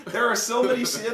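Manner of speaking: usual speaking voice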